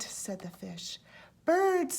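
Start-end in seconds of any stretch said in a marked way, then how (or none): 1.4-2.0 mysterious. A narrator's reading. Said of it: "said the frog mysteriously".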